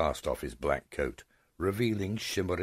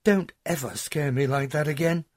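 high, louder motherly scolding voice; relieved mother; 11 semitones higher, 6 dB louder than usual. Acted. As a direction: scold her out of sheer relief. Mother scolds frightened daughter.

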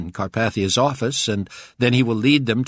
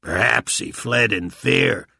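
slow, louder gravelly voice; 3 semitones higher, 5 dB louder, slower than usual. A narrator's reading. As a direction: growl it slowly, gravelly. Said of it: "said with a gravelly voice".

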